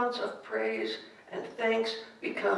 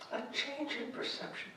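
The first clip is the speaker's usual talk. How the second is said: the phrase "A change in perception" repeated much softer; soft echo; 4 semitones higher, 9 dB quieter than usual. Shown as a demonstration. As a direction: let the echo sink in, soft.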